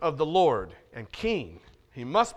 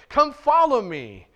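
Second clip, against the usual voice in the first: ringing call; 7 semitones higher, 6 dB louder, slower than usual. Acted. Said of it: "his first calling of disciples".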